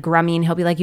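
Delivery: ordinary talk, nothing marked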